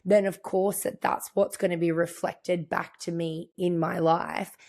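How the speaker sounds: ordinary talk, nothing marked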